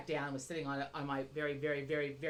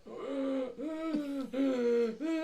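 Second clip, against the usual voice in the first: loud, drawn-out imitation of machine breaths; higher, mock Darth Vader mask wheeze; Darth Vader breathing; 8 semitones higher, 4 dB louder than usual. Wife mimics husband's sleep machine, noisy Darth Vader-like breathing. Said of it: "like Darth Vader last night".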